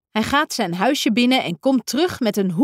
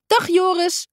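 high called greeting; calling out; 9 semitones higher than usual. A narrator's reading. Don roept Joris bij zijn huis, calling out high. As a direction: call across to Joris. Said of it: "roept Don".

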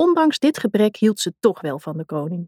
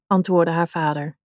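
plain reading voice

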